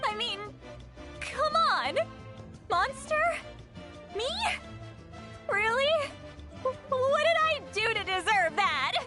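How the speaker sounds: high, incredulous protest; can't believe they call her that; indignant disbelief. Acted. Granny outraged at being called monster.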